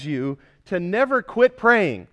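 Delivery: loud, driving sermon voice; fervent preaching. Acted. Pastor urging the congregation never to quit praying, loud and fervent. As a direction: press the congregation hard.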